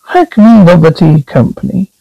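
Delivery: ordinary talk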